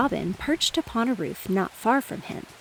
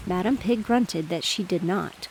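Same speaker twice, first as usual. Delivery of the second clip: usual speaking voice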